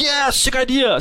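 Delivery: high, sarcastic hype; mock enthusiasm. Mocking agreement with the partner's idea, high sarcastic voice. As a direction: fake excitement, way up high.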